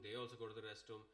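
usual speaking voice

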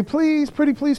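high-pitched mock begging; mock pleading. Judean dignitaries begging the Assyrians, in a high mock plea. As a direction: beg sweetly like a kid asking for candy.

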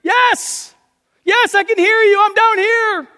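very high, loud eager reply; desperate shout. Acted. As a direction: shout back up in relief. Jack answering from the cliff.

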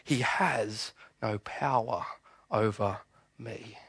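slow, soft, deliberate; hushed and slow defiance; quiet Jesus quote. Acted. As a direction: let each word land quietly. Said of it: "And listen to what he says".

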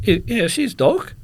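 high, loud answer in mock broken English; echoing the guard. Traveller confirming to guard, high mimicking reply. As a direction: answer back in the guard's own style. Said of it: "I says".

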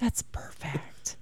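slow, breathy whisper. Real, not acted. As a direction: whisper it slowly.

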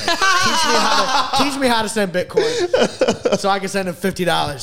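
high, loud, slow words through laughter; laughing. Real, not acted. Laughing about making the hacker teach him Bitcoin, real laughter. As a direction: crack up while telling it.

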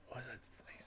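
real whisper, barely audible; whispered self-question. Real, not acted. Speaker who lost his thread asking himself, whispered. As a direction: whisper it to yourself.

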